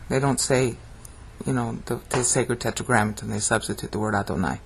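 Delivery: usual speaking voice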